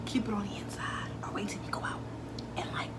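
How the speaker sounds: whispered, secretive advice; soft whisper, keeping it inside. Shown as a demonstration. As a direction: hush it like a secret. Advice to keep joy hidden.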